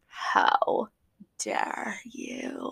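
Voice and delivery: breathy whispered how dare you; mock-scandalised whisper; whispered outrage. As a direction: hiss the indignation under your breath. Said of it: "Amanda was like".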